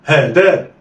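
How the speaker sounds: "ها دا" joined in one quick go; snatch "ها دا" with no pause; snatched together. Shown as a demonstration. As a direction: blend "ها دا" like one word. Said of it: "اخطف".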